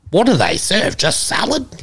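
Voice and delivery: high-pitched incredulous imitation; mock scoffing. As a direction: scoff like a baffled meat-eater. Retold country person scoffing at vegans.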